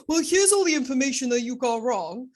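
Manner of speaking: a hint of a pedantic father correcting; mock know-it-all dad. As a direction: tease like a dad pointing out your mistakes. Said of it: "he'll be like".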